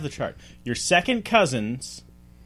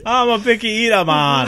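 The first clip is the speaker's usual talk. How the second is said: mock Jamaican accent; 6 semitones higher, 5 dB louder than usual. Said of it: "automatically Jamaican".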